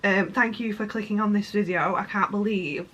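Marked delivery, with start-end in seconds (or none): none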